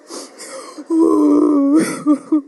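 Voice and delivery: high, loud sobbing laugh; imitating an ugly cry with a laugh; laughing cry sob. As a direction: break into a teary laugh-cry. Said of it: "you know, the ugly cry".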